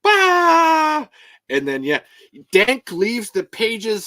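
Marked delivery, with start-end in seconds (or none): 0.0-1.1 sudden high yell to startle; jump scare shout. Pretend. Jump scare shout for fan Daniel. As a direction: leap out with a loud Bah. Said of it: "quick Daniel jump scare".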